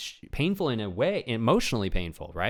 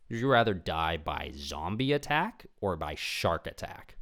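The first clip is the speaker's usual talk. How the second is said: ordinary talk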